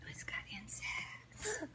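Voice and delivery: whispering. Real, not acted. Mom pointing at map.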